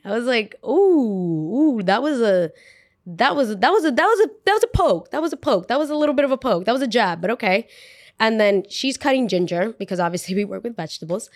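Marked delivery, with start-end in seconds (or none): 0.6-7.7 high, loud ooh, that was a poke; sputtering in mock shock; rising, stammering surprise at the insult; stunned reaction. Reacting to the jab.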